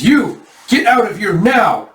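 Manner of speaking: high, loud, drawn-out order; commanding shout. Acted. Yona on the roof to bandits, high slow commanding shout. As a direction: shout down at the intruders.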